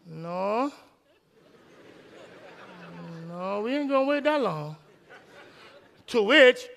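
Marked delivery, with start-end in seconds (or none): 0.1-4.8 flat refusal. Retold or imitated. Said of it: "To which I said".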